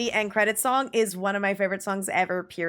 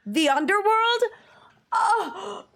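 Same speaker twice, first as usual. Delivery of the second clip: choked up; 10 semitones higher, slower than usual. Real, not acted. Emotional reaction to song title.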